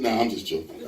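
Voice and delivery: low, soft throwaway; deadpan. Retold or imitated. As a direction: drop it flat and quiet. Undercutting the joke.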